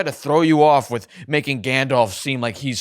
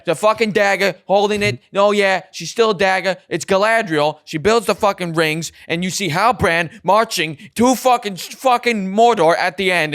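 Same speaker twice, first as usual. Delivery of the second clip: worked-up rant; 6 semitones higher, 5 dB louder than usual. Real, not acted. Heated rant about the plot twist.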